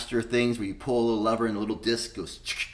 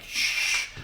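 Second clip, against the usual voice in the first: shushing hiss. Retold or imitated. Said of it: "I'm like".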